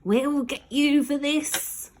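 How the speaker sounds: high, slow vengeful cry; caged grown-ups crying threats at the sky; angry threat. Acted. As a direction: rattle the bars and threaten them. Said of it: "they cried".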